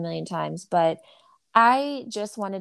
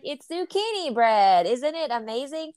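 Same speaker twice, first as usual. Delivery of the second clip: retold proud reveal; 5 semitones higher than usual. Mom revealing the secret ingredient to kids, exaggerated delight. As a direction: sell it to the kids, stretched and high.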